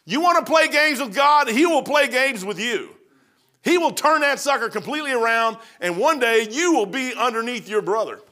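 high, loud preacher's warning; full-force preaching. Real, not acted. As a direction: drive it home from the pulpit.

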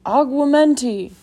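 loud, drawn-out cry, pitched high; ringing wizard's command; spell incantation. A narrator's reading. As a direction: call out the spell slowly and forcefully. Hagrid casts a spell aloud.

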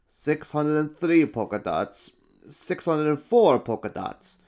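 a fussy counter ticking off numbers; counting official. Acted. Official counter counting the emperor's polka dots.

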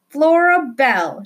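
high loud call of her name; shouted. A narrator's reading. Boy calling to Flora in the car, shouting. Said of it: "shouted William Spiver".

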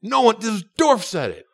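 high-pitched, drawn-out crowing; excited exclamation. Retold or imitated. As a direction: exclaim it in high, stretched-out amazement. Acting out an excited improviser's reaction.

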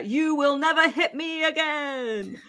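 high-pitched imitated yell without real volume; retold mock shout. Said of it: "then he shouted at her".

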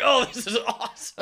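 giddy, laughing high gush; excited. Retold or imitated. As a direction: squeal it like a thrilled passenger. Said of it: "I'd be like".

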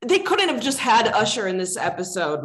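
usual speaking voice

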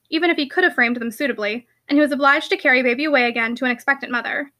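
ordinary delivery, nothing marked

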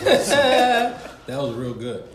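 big burst of laughter; laughing through the words; laughing hard. Real, not acted. Laughing at friend's joke.

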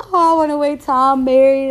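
exaggerated goody-goody pretence; put-on virtuous act. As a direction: mimic someone pretending to save themselves for marriage. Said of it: "I'm not gonna sit here and act".

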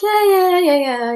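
mock the dad who isn't really listening; hammed-up "yeah, yeah" from a doubting dad; dismissive dad. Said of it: "he'd be like".

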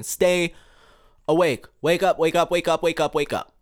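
rally the listeners awake; insistent repeated wake-up call; urgent call. Real, not acted.

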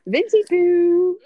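mocking singsong. Teasing a nickname together.

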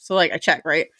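usual speaking voice

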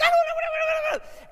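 very high, loud panicked babble; mock freak-out. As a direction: imitate someone losing it, words tumbling out. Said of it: "just someone's freaking out".

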